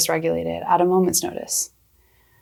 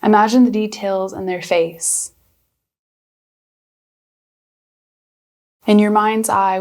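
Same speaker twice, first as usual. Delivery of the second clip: guided meditation. Acted. Counseling intern guiding viewers through breathing, calm meditation voice.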